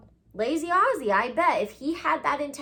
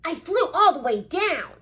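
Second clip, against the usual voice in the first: high, loud proud little owl; 6 semitones higher, 5 dB louder than usual. Acted. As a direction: boast to mother. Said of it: "he said proudly".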